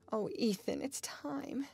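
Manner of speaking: high, mild cry of dismay; distressed cry. A narrator's reading. Girl hearing the clock strike, crying out in distress. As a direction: cry it out, climbing in pitch. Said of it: "she cried".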